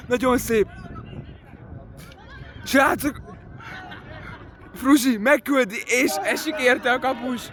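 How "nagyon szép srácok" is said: usual speaking voice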